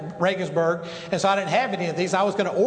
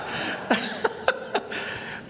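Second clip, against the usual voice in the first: high breathless laugh; 4 dB quieter than usual. Real, not acted. Laughs at his own joke.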